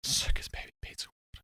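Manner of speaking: whispered name; breathy whisper of the name. Shown as a demonstration. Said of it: "repeats it whispered".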